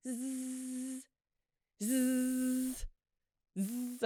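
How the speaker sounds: buzzing like a dentist drill; drill buzz imitation. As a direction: zzz drill noise.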